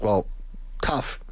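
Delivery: ordinary talk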